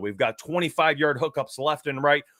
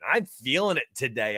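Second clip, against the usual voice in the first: usual speaking voice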